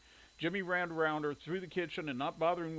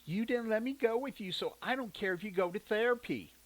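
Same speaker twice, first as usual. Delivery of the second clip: put-on sulky girl; 7 semitones higher, 3 dB louder than usual. A narrator's reading. Sulking little sister.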